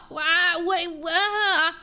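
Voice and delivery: exasperated, wordless stammer; baffled spluttering. Real, not acted. At a loss for words.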